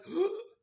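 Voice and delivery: shocked gasp. Retold or imitated. To the German mom, a loud shocked gasp. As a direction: gasp as if stunned.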